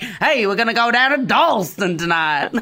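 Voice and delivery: a light hammed-up New Orleans accent. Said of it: "sounded like he was in New Orleans".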